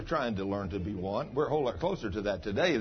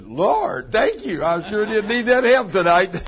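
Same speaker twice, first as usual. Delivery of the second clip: higher, louder thanks to the Lord; loud grateful outcry; 9 semitones higher, 11 dB louder, slower than usual. Retold or imitated. Pastor retells his own loud thanks to God. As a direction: cry out in joyful thanks. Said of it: "I looked up and said".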